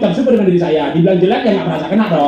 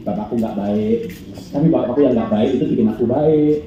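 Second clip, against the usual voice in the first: grateful prayer; 7 semitones lower than usual. Acted. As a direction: pray sincerely to yourself in the mirror. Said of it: "di depan kaca".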